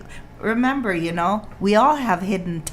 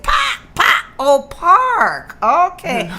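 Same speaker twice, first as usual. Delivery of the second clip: put-on very high, louder childish park; toddler voice; 9 semitones higher, 6 dB louder, slower than usual. Mother imitating her toddler's squeaky park and her own reply, high and loud. Voicing toddler then herself.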